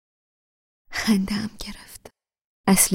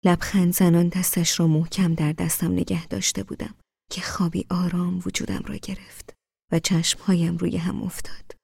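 ordinary delivery, nothing marked

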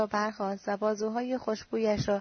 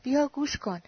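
raised, urgent call; a sharp cry to come listen; 4 semitones higher, 3 dB louder than usual. A narrator's reading. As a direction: call him over to the phone, alarmed. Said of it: "فریادی کشید".

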